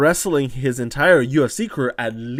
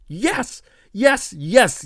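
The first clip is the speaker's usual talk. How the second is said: excited yes; 7 semitones higher, 4 dB louder, much slower than usual. Real, not acted. Excited about the matchup.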